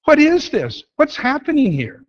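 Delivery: high-pitched, baffled protest; exasperated disbelief. Acted. Fed-up student confronting the professor, exasperated disbelief. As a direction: demand an explanation in rising pitch. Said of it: "you've had about all you can take".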